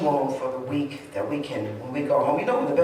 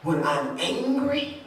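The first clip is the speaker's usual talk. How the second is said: high, slow, disbelieving questions that swell louder; shocked at herself; 9 semitones higher, 6 dB louder, slower than usual. Retold or imitated. Woman seeing her angry face in the mirror, high and aghast. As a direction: stare at your own reflection in horror. I was like.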